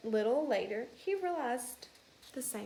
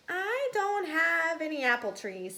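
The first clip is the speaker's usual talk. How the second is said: higher, louder little mouse; eager young mouse; 7 semitones higher, 5 dB louder than usual. Acted. House mouse telling classmates, higher and eager. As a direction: pipe up like an excited kid.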